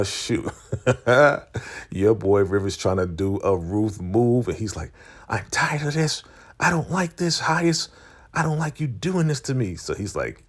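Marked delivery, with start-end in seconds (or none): 5.3-9.8 high-pitched imitation of a fed-up follower; mock whiny protest. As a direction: act out his complaint in a raised voice. River's protest acted in retelling.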